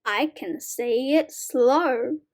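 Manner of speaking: normal talking voice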